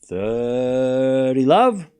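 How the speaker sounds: very slow, stretched score call; long stretched umpire call; drawn-out call. Acted.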